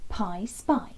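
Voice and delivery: usual speaking voice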